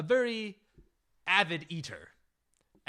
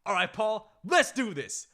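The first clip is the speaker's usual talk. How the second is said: louder, higher pep talk; rally him to start eating; loud hype; 7 semitones higher, 7 dB louder than usual. Retold or imitated. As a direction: friends urging Paul to take the first bite, loud hype. Friends urging Paul to eat.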